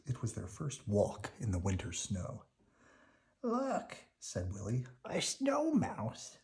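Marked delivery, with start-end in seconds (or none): 3.4-4.0 high, bright call of discovery; a child spotting something wonderful; excited child. Acted. Willie pointing out the snow mouse to his sister, excited. 5.1-6.4 high, slow voice of wonder; wide-eyed child. Acted. Willie showing his twin a snow mouse, high and marvelling.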